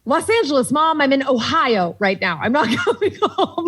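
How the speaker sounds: high-pitched, exasperated retort to mom; mock exasperation. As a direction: act out protesting to your mother, laughter creeping in. Acted phone quarrel with mom.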